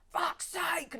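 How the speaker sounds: angry dad outburst. Retold or imitated. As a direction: snap it out like a furious dad over a scratched car. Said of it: "he just goes mad at you".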